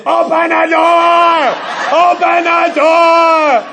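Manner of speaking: put-on high, loud, slow kids' yell; kids yelling. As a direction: holler it through the slot. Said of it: "open up their mailbox and say".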